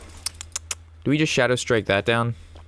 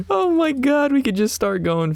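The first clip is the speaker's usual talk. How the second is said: much higher, slow, thrilled realization; excited; 13 semitones higher than usual. Real, not acted. Streamer to chat spotting lethal, thrilled high exclamation. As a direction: let it burst out as you spot the win.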